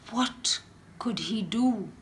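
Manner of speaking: whispered worry. A narrator's reading. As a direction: whisper it anxiously. Worried inner thought.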